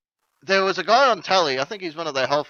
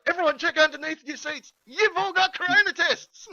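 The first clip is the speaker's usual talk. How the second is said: higher, excited game-show giveaway; Oprah impression; 10 semitones higher than usual. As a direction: hand out prizes like a talk-show host. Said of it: "be like Oprah".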